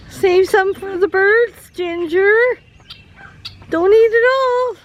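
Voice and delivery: high, playful telling-off; mock scolding. Real, not acted. Scolding a hen.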